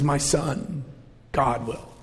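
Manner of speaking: quiet and low, voice catching; choked voice. Retold or imitated. Father answering his son's question, choking up quietly. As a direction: let the words catch in your throat. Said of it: "He choked".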